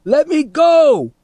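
high, drawn-out struggling yell; shouted. A narrator's reading. Teddy pulled off the fence, shouting. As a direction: yell to be released, long and high. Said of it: "Teddy shouted".

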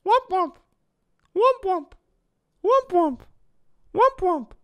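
very high, squeaky falsetto; cute VTuber noise. Retold or imitated. Streamer practicing a VTuber catchphrase, cute falsetto. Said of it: "some cute noise that she does".

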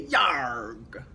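gruff pirate yarg; pirate grunt. A narrator's reading. Pirate answering the candy elf, gruffly. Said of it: "He'll say".

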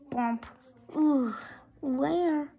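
usual speaking voice

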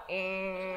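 long droning "ehhh"; imitated lift drone. Wife trying to storm off on a slow lift, droning its noise. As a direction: drag out the slow lift's mechanical hum. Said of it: "when you're like".

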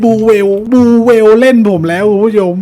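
high, loud excited shouting; thrilled yelling. Real, not acted. Streamer yells to viewers as the whale suddenly bites, high and loud. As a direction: yelp with real joy as the fish bites.

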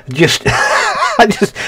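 slow words broken by high laughter; laughing. Real, not acted. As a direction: giggle between the words. Still laughing at the choice.

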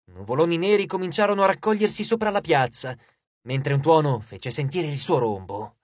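ordinary delivery

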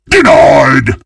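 loud, high triumphant shout; big gloating game call-out. Acted. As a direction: shout it like slamming a door on a rival.

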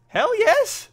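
high, loud whoop of triumph; elated cheer. Real, not acted. Player got the wonder weapon first try, elated shout. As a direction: cheer it, voice leaping up.

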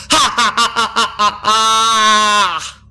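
loud, high villain cackle; evil laugh. Acted. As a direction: shout out a gloating laugh.

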